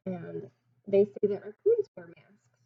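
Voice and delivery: ordinary delivery, nothing marked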